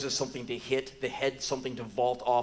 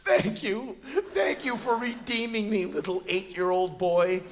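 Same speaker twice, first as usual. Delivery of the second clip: higher, hammed-up emotional thanks; overwhelmed, higher-pitched mock sincerity; mock-tearful gratitude; 6 semitones higher than usual. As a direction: gush as if redeemed by a child. Overcome by the boy's hug.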